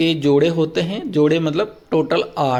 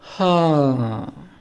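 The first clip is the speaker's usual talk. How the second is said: a very slow, drawn-out हाँ that sinks in pitch; weary sigh-like हाँ, slowly falling; tired falling tone; 3 semitones lower, much slower than usual. Shown as a demonstration. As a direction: let हाँ drop like a worn-out man. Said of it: "जैसे आदमी थक जाता है ना तो वो बोलता है".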